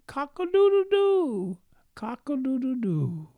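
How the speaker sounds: high crowing cock-a-doodle-doo; rooster crow. Retold or imitated. The rooster crowing in the city, high mock crow. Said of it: "a rooster went".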